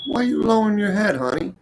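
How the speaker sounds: high, sweet coaxing voice for the sad cook; tender coaxing. Retold or imitated. Comedian consoling the shop lady, sweet and high. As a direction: coax her head up like a kind uncle.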